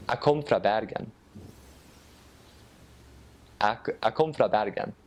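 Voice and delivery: Northern Norwegian, nasal and forward; clipped Northern Norwegian, kom for kommer; Northern Norwegian dialect. Shown as a demonstration. Guest showing the host the Northern Norwegian dialect. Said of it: "then in the north".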